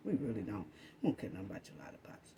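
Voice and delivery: fast hushed whisper. Real, not acted. Aside to her family, fading to a rushed whisper. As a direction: drop to a whisper and rush it.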